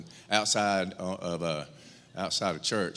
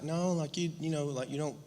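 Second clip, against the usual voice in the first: high, lightly voiced daughter; a hint of the daughter explaining; gently playing his daughter's answer; daughter's reply; 6 semitones higher, 7 dB quieter than usual. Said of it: "she's like".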